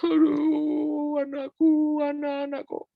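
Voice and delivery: put-on high, wailing lament for the child; mock wailing. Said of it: "Tadinya nangis".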